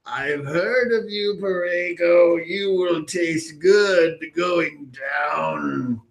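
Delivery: put-on high, slow accented dragon; slow menacing dragon with an accent. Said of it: "does he have, like, a, uh, an accent".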